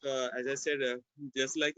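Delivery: ordinary talk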